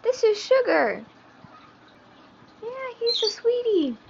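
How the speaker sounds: high, sing-song pet talk; cooing to a pet. Real, not acted. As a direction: coo sweetly to the little bird. Cooing to her new pet bird.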